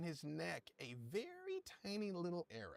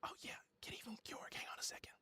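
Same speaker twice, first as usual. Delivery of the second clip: hushed, quick character whisper; whisper in the ear; 5 dB quieter, much faster than usual. Acted. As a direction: whisper secretly to one player. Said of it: "Leonis, in your ear".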